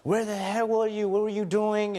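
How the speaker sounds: a touch of a jealous wife's yell; retold yelling. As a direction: replay her shouted demand in a half-hearted yell. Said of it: "she's screaming and yelling".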